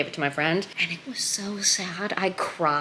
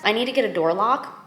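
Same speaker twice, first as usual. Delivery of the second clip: ordinary talk, nothing marked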